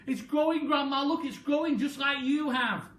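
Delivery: high, loud thrilled call; excited boy. Acted. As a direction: point and shout to Grandma. George excited.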